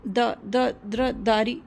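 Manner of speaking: stumbling over the name, fearful; a scared girl mangling a hard name; nervous stammer. A narrator's reading. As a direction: trip nervously on "द, द, द्र" before blurting "दारी". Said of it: "उसकी जुबान लड़खड़ा रही थी".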